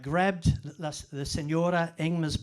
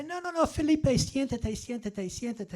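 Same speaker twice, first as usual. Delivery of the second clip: imitating Evelyn; 8 semitones higher than usual. Eldest daughter urging Felipe to sit, high and insistent. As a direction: mimic the daughter pressing him to sit, high then trailing off. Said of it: "she'd say".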